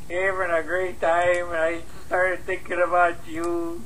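slurring, weepy, overly sentimental call; drunk late-night voicemail. Acted. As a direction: pour your heart out, tipsy and teary.

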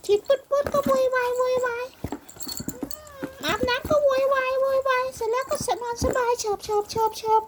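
high, sing-song cooing with chanted repeats. Real, not acted. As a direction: chant the repeated words teasingly to a little one. Baby-talk teasing about bath time. (เสียงสูงหยอกเด็กเป็นทำนอง)

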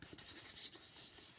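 soft unvoiced psst sounds; whispering psst. Mom whispering to dad, soft psst noises. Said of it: "she's going".

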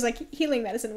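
normal talking voice

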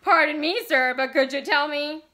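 loud, high mock cheer on the edge of breaking. A narrator's reading. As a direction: put on gaiety, pitched high and close to cracking.

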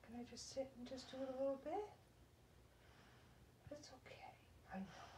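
very soft, coaxing murmur; calm the frightened pet almost in a whisper; gentle and barely voiced; hushed soothing. Real, not acted. Calming a scared pet.

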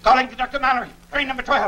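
desperate hail over the radio; urgent call. Acted. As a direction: rush the call out to warn the train. Urgent radio call after the threat.

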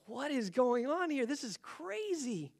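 high-pitched astonished reaction; amazed. Retold or imitated. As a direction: marvel at it, stretching the last words. Retold amazement at her words.

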